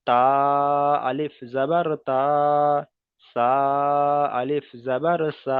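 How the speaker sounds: আলিফ জাবার বা recited slowly, বা held long; slow recitation drill. Shown as a demonstration. Teacher reciting the Qaida lesson slowly. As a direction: chant each syllable slowly. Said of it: "কেমন করে পড়বো".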